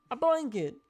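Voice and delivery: high frog puppet voice. Pretend. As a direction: still stuck in the frog voice. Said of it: "I get stuck in the voice".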